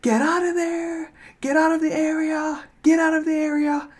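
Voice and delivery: long, high-pitched pretend yell; imitated yelling. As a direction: imitate officers calling from a distance. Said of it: "They were yelling".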